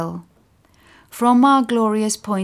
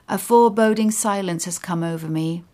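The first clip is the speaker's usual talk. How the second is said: ordinary delivery, nothing marked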